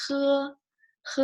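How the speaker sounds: "He" with friction at the top of the throat; throaty Mandarin h. Shown as a demonstration. Teacher repeating for learners, throaty h. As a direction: hold "he" high and level with a scraped h. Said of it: "on the top of your throat".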